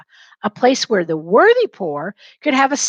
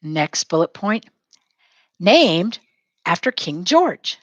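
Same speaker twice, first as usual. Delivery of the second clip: usual speaking voice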